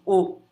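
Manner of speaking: O as a short closed ô. Shown as a demonstration. Teacher showing timbre fechado, closed O. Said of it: "Com o timbre fechado assim".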